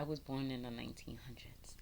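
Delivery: hushed confiding whisper. Real, not acted. Vlogger confesses her age to viewers, whispered. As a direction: let the secret slip in a whisper.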